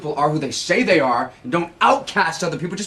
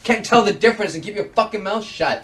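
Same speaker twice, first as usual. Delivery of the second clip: heated, high-pitched retort; angry; 5 semitones higher than usual. Acted. Man denying to his accuser that he bullies, angry. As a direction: lash out in indignant denial.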